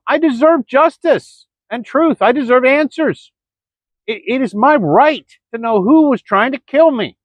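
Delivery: upset man pleading to the judges, voice climbing in anger; real angry plea. Real, not acted. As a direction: demand your rights with mounting outrage. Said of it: "I'm angry, frustrated".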